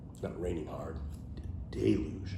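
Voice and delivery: Deluge whispered with a falling tune. Shown as a demonstration. Viewers hear the new word Deluge whispered. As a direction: breathe the word Deluge softly.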